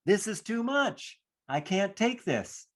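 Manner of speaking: put-on high, small child's voice; childlike whine, pitched well up; little child overwhelmed. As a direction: speak it as a three-year-old at the end of their rope. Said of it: "the three-year-old that said".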